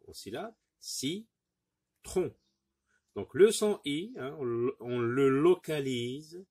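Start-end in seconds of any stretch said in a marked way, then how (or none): 0.8-2.4 "Si" raised, a pause, then "tron"; cut into syllables. Shown as a demonstration. Teacher cutting the word into syllables, "Si" apart from "tron". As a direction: say "Si" and "tron" as two separate beats. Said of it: "On découpe le mot en".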